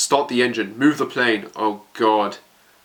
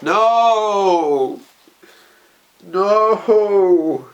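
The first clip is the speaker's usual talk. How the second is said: high pained wail, louder; anguished no; 8 semitones higher, 5 dB louder than usual. Real, not acted. Fan watching Hanji say goodbye, high anguished wail. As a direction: cry out against what is coming.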